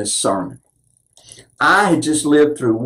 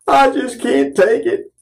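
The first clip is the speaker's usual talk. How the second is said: anguished cry quoted; 7 semitones higher than usual. Retold or imitated. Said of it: "would ball up his fists and say".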